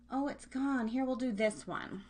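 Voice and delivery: usual speaking voice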